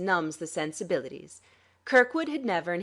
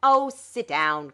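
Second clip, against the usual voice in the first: slow, louder scornful "Oh, sit down"; contemptuous; 8 dB louder, slower than usual. A narrator's reading. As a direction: brush him off with contempt. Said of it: "Calendar cut in contemptuously".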